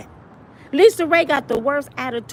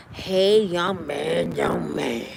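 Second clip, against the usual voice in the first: put-on very low breathy old lady; old woman purr; 9 semitones lower, 5 dB quieter, much slower than usual. Vivica greets a young man, low old-lady purr. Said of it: "She done turned to that".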